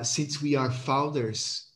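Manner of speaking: ordinary delivery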